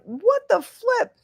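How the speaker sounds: high, drawn-out mock dismay; exasperated disbelief. Owner reacts to dog's antics, in mock exasperated disbelief. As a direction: stretch it out high, incredulous. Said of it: "I'm like".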